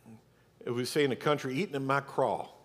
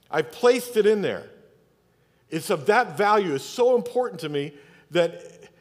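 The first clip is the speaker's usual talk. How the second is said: higher, louder, insistent sermon delivery; impassioned preaching; 5 semitones higher, 4 dB louder than usual. Real, not acted. Preacher urges congregation to treasure scripture, impassioned. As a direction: preach it with mounting force and punched key words.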